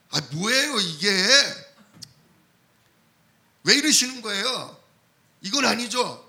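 whiny indignant protest to God; mock complaint. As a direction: act out a grumbling believer, rising in pitch. 원망하는 성도 흉내. (하나님께 불평하는 흉내, 투덜대듯)

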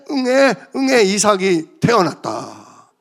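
ordinary talk

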